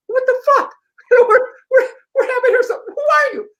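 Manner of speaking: very high-pitched, sputtering mock outrage; outraged disbelief. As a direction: imitate flustered strangers protesting an intruder. Acts out the booth group's outraged reaction.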